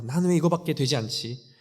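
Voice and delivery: high, loud despairing cry at herself; 자책하며 울부짖듯이; anguished self-reproach. Acted. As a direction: wail it out as if blaming yourself for everything. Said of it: "자책하고 울고 있던".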